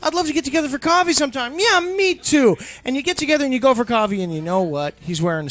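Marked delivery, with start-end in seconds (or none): 0.0-2.6 high, loud, gushing reunion; hammed-up delight at an old friend; both friends gushing on the phone, excited; mock excited reunion. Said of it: "And you're like".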